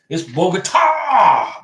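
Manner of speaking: Bogota with a long, loud, drawn-out final A; slow and loud, leaning hard on the last A of Bogota; stress on the A. Shown as a demonstration. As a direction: stretch and punch the A in Bogota. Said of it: "The A has an accent".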